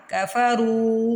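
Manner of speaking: کفرو with a long drawn رو; stretched ru. Shown as a demonstration. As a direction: slowly pull out رو in کفرو. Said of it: "رو کو کھینچنا ہے". (کفرو میں رو کو کھینچ کر)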